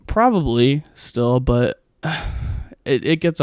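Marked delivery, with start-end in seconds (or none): none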